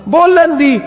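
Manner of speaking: slow, high, drawn-out recitation; devotional verse recitation. Acted. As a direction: raise the refrain high and stretch it out. (بلند آواز میں ٹھہرا ہوا صوفیانہ کلام)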